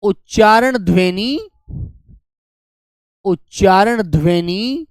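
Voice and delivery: slow, drawn-out dictation of a term. Shown as a demonstration. Teacher dictating to students, slowly. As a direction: say each word slowly for copying. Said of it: "वो भी लिख लीजिए".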